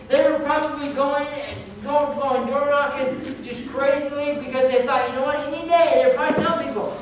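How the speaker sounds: loud, high, driving sermon delivery; fervent preaching. Acted. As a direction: preach it urgently to the congregation. Preaches at full force.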